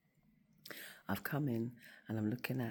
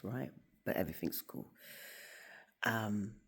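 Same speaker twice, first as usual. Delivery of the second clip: ordinary talk, nothing marked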